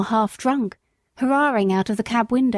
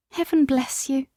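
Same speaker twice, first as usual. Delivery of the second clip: mild tearful catch, slightly higher; tearful thanks; 4 semitones higher than usual. Acted. Mother thanks Jerry, about to cry. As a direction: thank him near tears. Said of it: "burst into tears".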